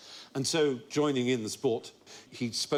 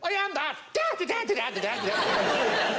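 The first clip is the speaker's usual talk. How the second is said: high Irish leprechaun babble; mock Irish gibberish; 12 semitones higher, slower than usual. Man playing leprechaun to children, high mock-Irish gibberish. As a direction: declare it, then burst into Irish nonsense. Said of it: "sort of Irish gibberish".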